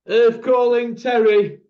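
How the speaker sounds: slow, loud, high-pitched call; hailing. Real, not acted. Host hails Terry, who isn't answering, calling out. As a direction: hail him slowly as if he's miles away.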